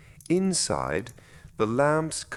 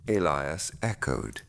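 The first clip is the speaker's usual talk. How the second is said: ordinary delivery, nothing marked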